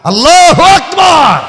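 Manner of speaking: very loud, high, drawn-out shout; slow, roaring religious call; shouted takbir. Real, not acted. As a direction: rouse the crowd at full force. Preacher calls out takbir to crowd.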